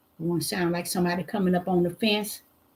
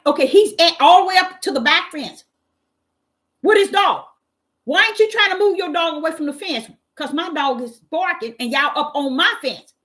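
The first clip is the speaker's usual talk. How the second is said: indignant inner rant; 7 semitones higher, 4 dB louder than usual. Retold or imitated. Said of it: "I'm like".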